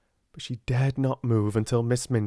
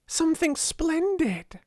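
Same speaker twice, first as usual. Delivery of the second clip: high, eager and quick; mild excited rush; excited hurry. A narrator's reading. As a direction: burst with the news. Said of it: "in an excited hurry".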